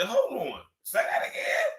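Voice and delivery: high squeaky retold reaction; acted high-pitched amazement; excited interruption. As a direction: cut in with a cracked high pitch. Acts out his excited reaction.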